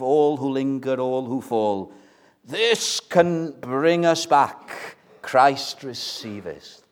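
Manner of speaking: slow, measured hymn recitation; hymn recited. Acted. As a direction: lift the hymn lines slowly.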